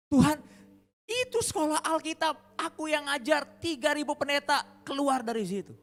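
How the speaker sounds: loud, high, indignant protest to God; shocked protest. Acted. Shocked pastor defends his Bible school to God, loud protest. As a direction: plead your record in loud disbelief. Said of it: "Kaget ya".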